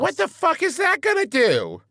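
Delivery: high-pitched incredulous outburst; comic outrage. Real, not acted. Co-host objects to the guard dog advice, comic outrage. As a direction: blurt it out in disbelief.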